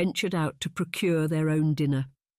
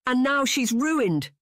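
much higher, slow, half-sobbing outburst; savage cry; 10 semitones higher, 4 dB louder, slower than usual. A narrator's reading. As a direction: wail it out savagely and slowly, near tears. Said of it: "Missus Wickliffe cried savagely".

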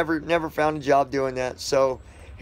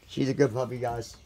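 ordinary talk

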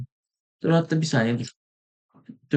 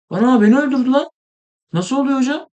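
shocked outburst; 9 semitones higher than usual. Real, not acted. Gamer killed by a stronger player, high disbelieving yelp. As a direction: yelp it like you just got killed.

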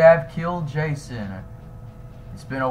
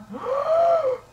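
sharp gasp. Acted. Diving under the water.

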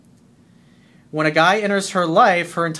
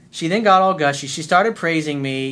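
ordinary talk

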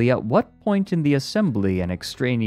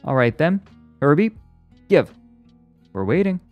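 higher, louder expectant demand; triumphant; 8 semitones higher, 6 dB louder, faster than usual. A narrator's reading. Psychologist tells robot to answer, triumphant. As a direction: press for the answer with confidence. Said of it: "there was triumph in the psychologist's voice".

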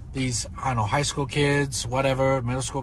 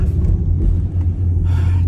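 heavy sigh; 2 semitones lower, 6 dB louder than usual. Acted. Dad sighs over TV cost.

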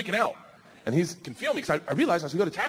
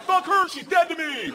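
high, drawn-out holler; acted-out shout while falling; yelled dismissal; 9 semitones higher, slower than usual. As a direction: yell it slowly in hammed-up indignation. Yelling during free fall.

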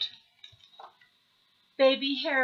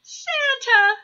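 very high, loud little owl call; childish squeal of greeting; delighted cry; 9 dB louder than usual. A narrator's reading. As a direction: cry out the name excitedly. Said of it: "cried Baby Owl".

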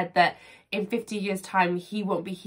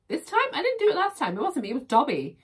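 mock indignant; 7 semitones higher, faster than usual. Playfully denying cake blame.

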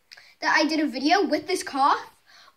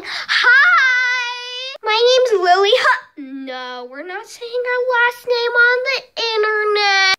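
bubbly intro; 8 semitones higher, 8 dB louder, slower than usual. Acted. Bubbly intro, sung correction.

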